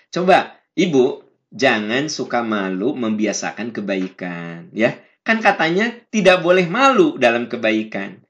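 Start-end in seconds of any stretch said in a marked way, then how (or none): none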